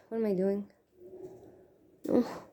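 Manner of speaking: normal talking voice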